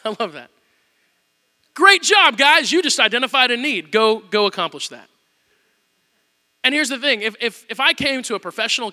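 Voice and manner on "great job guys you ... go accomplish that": higher, louder cheerful praise; Jesus hammed-up congratulating the disciples; mock cheer. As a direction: cheer them on with a wink. Jesus turns the tables, mock praise.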